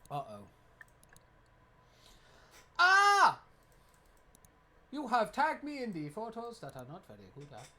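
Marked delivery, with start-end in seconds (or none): 2.8-3.4 high loud shriek; shriek of alarm. Real, not acted. Seeing a bad tagged photo, with a high loud shriek. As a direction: yelp like you've been caught.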